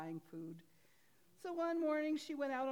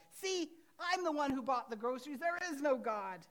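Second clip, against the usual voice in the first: louder, taunting neighbor; gloating yell; 5 semitones higher, 5 dB louder than usual. Retold or imitated. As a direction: crow over her, a bit louder. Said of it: "and yelled".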